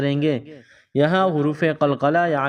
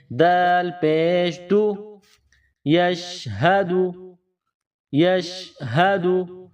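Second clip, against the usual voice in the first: یشہدو drawn out syllable by syllable; slow spelling drill; 2 semitones higher, much slower than usual. Shown as a demonstration. Teacher joining the whole word for pupils. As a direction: stretch every syllable. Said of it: "دال کے اوپر پیش ہے".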